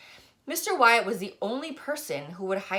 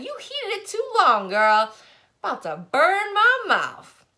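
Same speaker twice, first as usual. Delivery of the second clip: put-on high, loud bossy old barber barking orders; bossy old man; 11 semitones higher, 7 dB louder than usual. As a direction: boss the girl around like a cranky elder. Barber complains to the girl.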